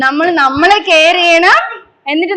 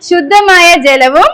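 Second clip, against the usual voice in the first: slow, projected recitation in short phrases; leading a pledge; slower than usual. Shown as a demonstration. Teacher leading children in an environment pledge, slow measured call-out. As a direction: call out each phrase firmly, pausing for the echo.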